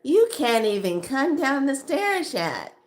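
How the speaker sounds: high-pitched imitation of a teasing friend; mocking taunt. Friend teasing her about the stairs, high and mocking.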